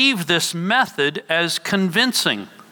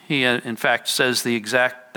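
ordinary delivery

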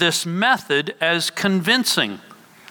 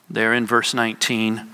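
normal talking voice

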